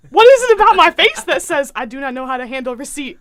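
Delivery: loud, indignant self-mocking rant; hammed-up indignant question; exasperated mock rant. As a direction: punch it out, theatrically put-upon. Mock rant to her friend.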